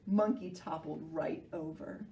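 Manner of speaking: ordinary delivery, nothing marked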